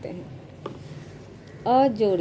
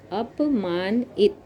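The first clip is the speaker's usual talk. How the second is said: teacher spelling it out slowly; slow syllable-by-syllable; much slower than usual. Shown as a demonstration. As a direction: sound out अपमानित slowly, part by part. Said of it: "teacher sounds out word slowly".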